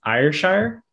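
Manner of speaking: a high, rising Scottish "Ayrshire?"; Scottish accent attempt. Shown as a demonstration. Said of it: "I'm trying to say it with a Scottish accent".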